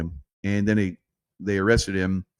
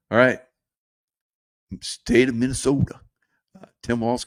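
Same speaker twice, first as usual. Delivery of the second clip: ordinary talk, nothing marked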